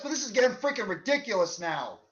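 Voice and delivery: normal talking voice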